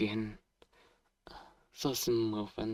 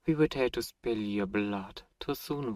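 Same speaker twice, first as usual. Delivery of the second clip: ordinary talk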